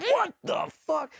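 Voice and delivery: high, stretched shocked cry; startled shout. Retold or imitated. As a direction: yelp it as a blast goes off overhead. RPG airburst overhead.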